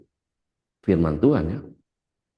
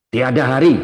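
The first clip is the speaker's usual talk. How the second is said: louder, higher preacher's slogan; rallying cry; 6 semitones higher, 8 dB louder, faster than usual. Real, not acted. Preacher closing his message to the congregation, rallying cry. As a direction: ring it out to rouse the congregation.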